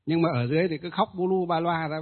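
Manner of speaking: ordinary delivery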